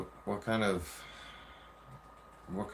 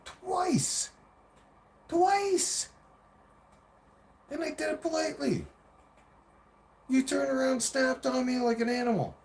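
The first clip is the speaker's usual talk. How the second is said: very high-pitched exasperated protest; squeaky-high indignant comeback; incredulous outrage. Retold or imitated. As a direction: throw the accusation back in disbelief, retelling it. Acted incredulous retort to his partner.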